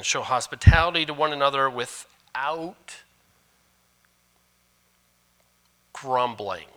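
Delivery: hospitality rising, grumbling falling firmly; corrected reading, stress on without. Shown as a demonstration. Pastor rereads the verse to the church, firmly. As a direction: read it again, leaning on without. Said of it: "Let's read that again".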